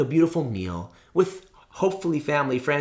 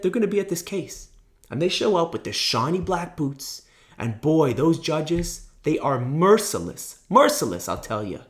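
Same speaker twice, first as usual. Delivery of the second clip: normal talking voice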